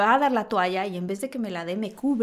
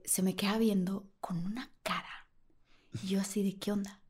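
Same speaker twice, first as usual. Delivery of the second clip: hushed retelling; 2 semitones lower, 9 dB quieter than usual. Retold or imitated. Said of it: "whispered storytelling".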